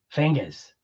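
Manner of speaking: "Fingers" said as fing-guhz in a Boston accent, no R at the end; a soft, low Boston "Fingers" with no R. Shown as a demonstration. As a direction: drop the R in "Fingers", Boston style. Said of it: "we don't do the E Rs".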